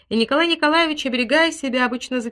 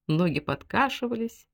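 usual speaking voice

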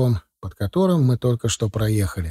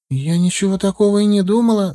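higher, nervous denial; timid protest; 7 semitones higher than usual. A narrator's reading. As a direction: rush the denial, a bit scared. Марина робко оправдывается. (робко и испуганно)

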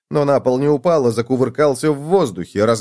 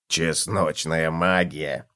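low, slow, soft amusement; amused cockroach; 7 semitones lower, 6 dB quieter, much slower than usual. A narrator's reading. Cockroach jokes about garlic magic, amused. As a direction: savour it quietly in a deep pitch. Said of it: "развеселился таракан".

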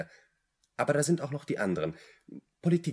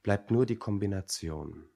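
ordinary delivery

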